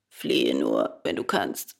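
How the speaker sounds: very low, husky dolphin; reply drily in a deep voice; deep croaky creature; hoarse dolphin. A narrator's reading. Dolphin answers the puppet sceptically.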